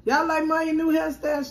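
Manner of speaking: high, loud, eager question; excited. Real, not acted. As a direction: show it off proudly. Showing off to viewers.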